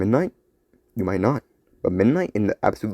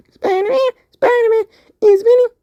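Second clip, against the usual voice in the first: much higher, louder squeaky call; excited squeak; 7 dB louder than usual. Acted. Vinny calling to Spider-Man, high squeaky shout. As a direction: shout out in a tiny voice.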